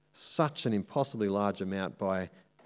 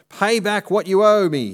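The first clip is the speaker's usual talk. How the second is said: high, loud, harsh demand for the money; 9 semitones higher, 8 dB louder than usual. A narrator's reading. Servant demanding his debt from a fellow servant, loud angry demand. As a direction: bark the order at the debtor. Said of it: "he demanded".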